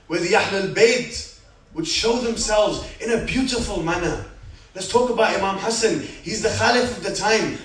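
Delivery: loud, rising sermon voice, building passion; preacher stirring the congregation, at full force; full-force preaching. Acted. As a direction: preach it out to the crowd with fire.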